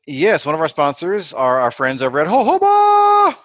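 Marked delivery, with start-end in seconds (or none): 2.3-3.4 high, loud, drawn-out announcer holler; sponsor shout-out. Pretend. Host introducing the podcast's sponsor, hammed-up high holler. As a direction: belt out the brand name, holding the last syllable.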